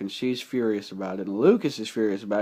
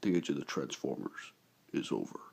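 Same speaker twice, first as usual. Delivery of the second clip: deep, menacing imitation of the villain; low and grave, like a final warning; ominous movie villain; 8 semitones lower, 10 dB quieter than usual. Retelling the villain's threat in a deep menacing voice. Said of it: "and then he says".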